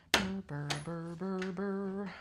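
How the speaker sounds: teeth-chattering burr sounds; shivery brr. Real, not acted. Shivering in the cold basement, buzzing brr.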